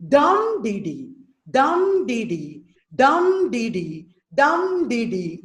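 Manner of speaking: slow chanted DUM dee dee, long first beat; dactylic beat. Shown as a demonstration. Teacher chants dactyl rhythm for students, dum stressed, dee dee light. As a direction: lean on dum, two light dees falling after. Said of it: "stressed, unstressed, unstressed".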